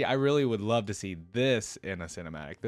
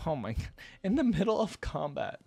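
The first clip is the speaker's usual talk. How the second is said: ordinary delivery, nothing marked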